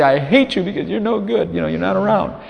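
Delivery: mock bitter outburst. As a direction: spit out a bitter grievance, lightly acted. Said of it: "he'd say".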